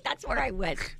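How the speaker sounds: ordinary talk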